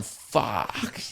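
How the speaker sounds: imitating an ogler under the breath; low lustful mutter. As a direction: mutter it like an ogling guy. Leering at female politicians.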